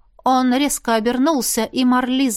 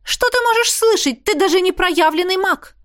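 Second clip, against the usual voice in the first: much higher, louder outraged scolding; indignant; 10 semitones higher, 3 dB louder than usual. A narrator's reading. Marlisa, outraged, scolds Jaf in a high voice. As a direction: scold him like an offended teacher. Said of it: "возмутилась она".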